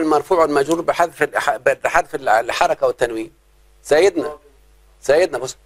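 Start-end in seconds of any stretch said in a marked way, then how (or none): none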